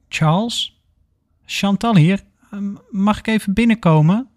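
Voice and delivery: lightly acted visitor asking to come in; tentative calling. A narrator's reading. Knocking, calls into patient's room.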